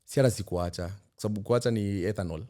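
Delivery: ordinary talk, nothing marked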